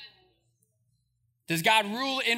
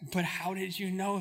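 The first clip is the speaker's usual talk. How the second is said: Nicodemus muttering; 4 semitones lower, 9 dB quieter than usual. Retold or imitated. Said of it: "And Nicodemus is like".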